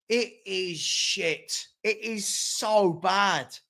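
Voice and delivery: very slow, deliberate, each word spaced; slow disgust. Real, not acted. Fed-up fan passes judgement on his team, very slowly.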